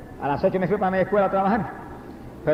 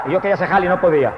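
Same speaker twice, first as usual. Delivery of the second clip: usual speaking voice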